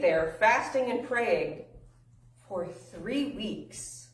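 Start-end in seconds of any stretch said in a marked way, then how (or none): 2.5-4.0 very slow, softer "three weeks"; drawn-out emphasis. Real, not acted. Preacher tells how long disciples waited, drawn-out emphasis. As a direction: stretch out "three weeks" to feel the wait. Stressing the length of the wait.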